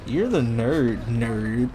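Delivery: ordinary delivery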